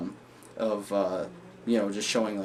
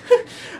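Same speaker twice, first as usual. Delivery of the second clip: short, loud burst of cackling; manic laugh; 8 dB louder than usual. Acted. Joker admitting he went crazy, brief mad cackle. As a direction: bark out one mad laugh.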